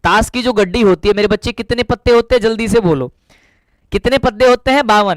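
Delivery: ordinary talk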